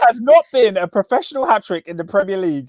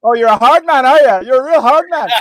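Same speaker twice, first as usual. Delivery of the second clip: louder, higher mock taunt; mocking Coleman; 5 semitones higher, 6 dB louder than usual. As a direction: sneer it like a sarcastic defender. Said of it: "Seamus Coleman's like".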